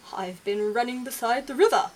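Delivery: deer voice. Acted.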